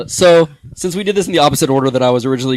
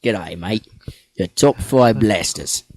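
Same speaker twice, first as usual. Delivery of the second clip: slow, badly faked Aussie accent; attempted Australian accent; 3 semitones lower, much slower than usual. Shown as a demonstration. Host shows co-host how to announce the list, mock Australian accent. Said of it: "in an Australian accent".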